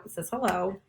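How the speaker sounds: ordinary talk, nothing marked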